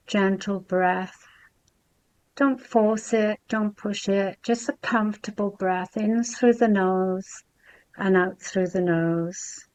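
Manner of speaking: soft, unhurried meditation voice with long pauses; guided meditation. Real, not acted. Host guiding class to breathe and be present, soft meditation voice. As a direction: ease them gently into stillness, softly.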